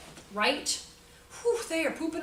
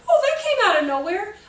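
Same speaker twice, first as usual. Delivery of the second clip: high, loud laughing "oh" of surprise; mock surprise; 8 semitones higher, 8 dB louder than usual. Teacher mimics her surprise at a child's outburst, high and laughing. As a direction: act caught off guard, laughing.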